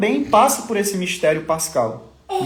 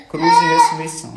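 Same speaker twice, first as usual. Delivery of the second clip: much higher and louder, almost sung out; emphatic proclamation; 6 dB louder than usual. Real, not acted. Father declaring faith to viewers, high exultant proclamation. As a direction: proclaim it triumphantly, voice lifting. Repeats motto, second time proclaimed.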